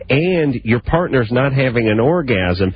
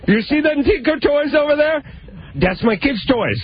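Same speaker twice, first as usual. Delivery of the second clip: much higher, heavy mock Vietnamese accent; Tom Vu impression. Radio host mocks an infomercial guru, high mock Vietnamese accent. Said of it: "my Tom Vu and my Minka".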